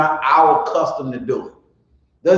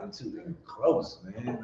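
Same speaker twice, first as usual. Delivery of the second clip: muttered complaint; 15 dB quieter, slower than usual. Retold or imitated. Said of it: "I'm like".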